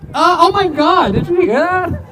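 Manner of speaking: high, loud mock outrage; mock shock. Comic mock shocked after a tourist's insult, high and loud. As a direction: play wounded disbelief, loud and high.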